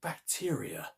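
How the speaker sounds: a wondering whispered "bacteria"; hushed whisper. A narrator's reading. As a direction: whisper "bacteria" softly, the word trailing away. Narrator repeats the revelation.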